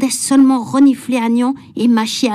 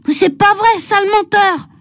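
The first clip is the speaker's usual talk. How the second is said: put-on indignant child; 7 semitones higher than usual. A narrator's reading. Nicolas accusing Agnan.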